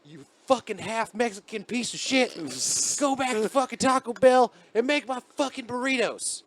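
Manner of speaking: high, sneering tweaker rant. Retold or imitated. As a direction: spit the slur in his voice. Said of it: "He's all".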